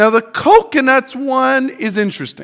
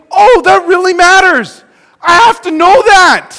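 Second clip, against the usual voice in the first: higher, louder and slow, alarmed; new parent alarm; 9 semitones higher, 8 dB louder, slower than usual. Retold or imitated. New parent realizing baby care matters, alarmed. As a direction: sound suddenly urgent like a new parent.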